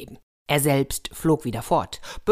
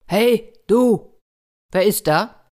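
loud, high call from afar; 6 semitones higher, 7 dB louder, slower than usual. Acted. Evil spirit calls to the soldier from the tower, calling out. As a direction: call down loudly to get attention. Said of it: "dass dort jemand rief".

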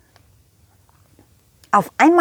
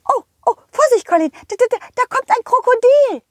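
squeaky frantic warning; high pony shouting a warning; excited high horse voice; 10 semitones higher, faster than usual. Acted. Rosa warns excitedly. (aufgeregte hohe Pferdestimme)

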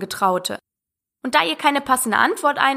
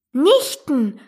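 higher exclaimed "Nichten"; called out; 4 semitones higher, 4 dB louder than usual. A narrator's reading. Alice mishearing, keen to meet nieces, exclaiming. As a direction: pipe up with eager curiosity. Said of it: "rief Alice".

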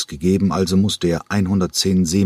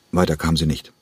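ordinary delivery

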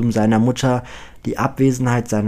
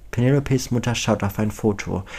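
ordinary delivery

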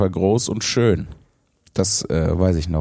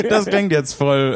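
high burst of laughter, slow words; giggling through the words; laughing; 13 semitones higher than usual. Real, not acted. Laughs at own awkward wording.

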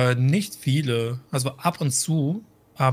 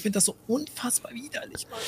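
hushed, disgusted whisper; 7 semitones higher, 9 dB quieter than usual. Real, not acted. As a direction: whisper how gross it is. Disgust at sauerkraut juice.

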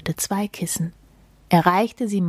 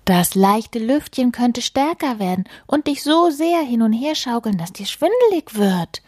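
higher, lightly worried storybook voice; fussy mole warning; 6 semitones higher than usual. Acted. Mole talking mouse out of the hammock, fussy warning. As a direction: warn with exaggerated concern.

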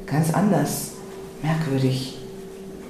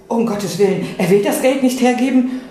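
higher and louder in sudden fright; alarmed; 7 semitones higher, 5 dB louder than usual. Acted. Else fears Dorsti will refuse the money, alarmed. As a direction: gasp it out in alarm.

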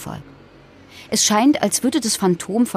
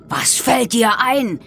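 slow, higher-pitched outraged demand; angry father's roar; 5 semitones higher, slower than usual. A narrator's reading. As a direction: roar it slowly, as a narrator voicing outrage. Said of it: "brüllte mein Vater".

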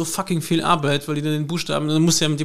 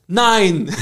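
stern, emphatic refusal; firm loud no; 5 semitones higher, 7 dB louder than usual. Retold or imitated. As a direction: plant your feet and say no. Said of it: "macht einen Schritt auf ihn zu und sagt".

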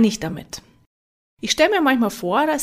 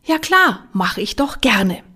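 eager yes; 3 dB louder than usual. Retold or imitated. Flattered speaker answering the organiser on the phone, eager. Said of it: "Und was sage ich?".

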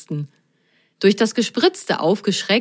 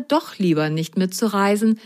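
ordinary delivery

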